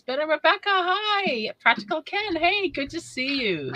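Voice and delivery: high, sing-out hello to arriving friends; bright greeting. Real, not acted. Host greets viewers coming into the house, bright greeting. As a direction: call out a welcome, pitched well up.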